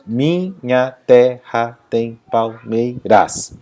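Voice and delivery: slow, evenly spaced syllables of "Minha terra tem palmeiras". Shown as a demonstration. Teacher showing grammatical syllables, slowly syllable by syllable on "Minha terra tem palmeiras". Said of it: "Separando em sílabas gramaticais".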